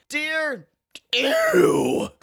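high squeaky "Dear Ew"; high-pitched mock disgust; mock squeal. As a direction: squeal it like a disgusted little girl. Mock letter salutation, squeaky voice.

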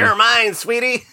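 high, loud cackling ghoul voice; hammy movie skeleton growl. Retold or imitated. Skeleton quote, Army of Darkness.

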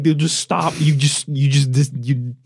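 ordinary delivery

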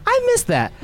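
high, nostalgic sigh of a quote; wistful mock longing. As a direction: say it like you truly miss it. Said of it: "and I was like".

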